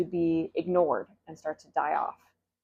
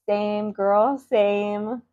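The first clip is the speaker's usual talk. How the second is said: put-on sympathetic "same girl"; 2 semitones higher, 5 dB louder, much slower than usual. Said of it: "start by saying".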